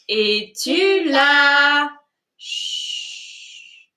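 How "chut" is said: soft hushing whisper; whispering over a crib. Acted. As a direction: whisper a sleepy shush. Sleeping thumb hushes listeners.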